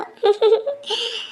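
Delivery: high, teasing giggle; acted chuckle of amusement; mocking laugh. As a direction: laugh at your brother's expense. Teasing a brother about housework.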